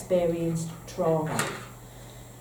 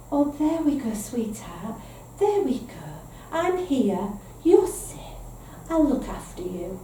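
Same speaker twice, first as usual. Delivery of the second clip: tender cooing, lifting high on there and safe; soothing a baby; 3 semitones higher than usual. Shown as a demonstration. Parent comforting a distressed newborn, tender and lilting. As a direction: coo gently to a newborn. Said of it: "They need us to say".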